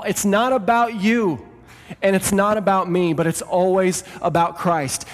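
building sermon delivery; full preaching. Acted. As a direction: preach it to the whole church with fire. Pastor preaching to church.

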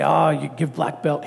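lightly voiced Korean teacher in broken English, slow; a slow hint of a Korean master's broken English; mild Korean-accented broken English, unhurried; Master Ku impression. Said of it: "This is my Master Ku impression".

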